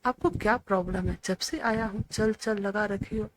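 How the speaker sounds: ordinary delivery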